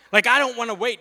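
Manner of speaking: high, loud impatient whine to son; impatient protest. Retold or imitated. Dad texting his son about waiting, impatient.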